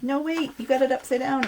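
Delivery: lightly voiced worried viewer; viewer warning. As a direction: call out to the video maker in mock panic. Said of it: "I'm like".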